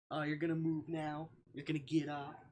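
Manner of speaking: normal talking voice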